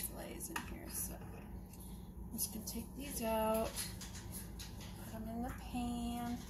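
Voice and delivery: whispered aside. Real, not acted. Vlogger whispers while handling fish fillets. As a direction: whisper it like a secret.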